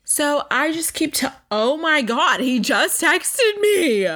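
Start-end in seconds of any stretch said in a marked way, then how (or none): 1.5-4.1 surprised. Real, not acted. Reacting to a new text.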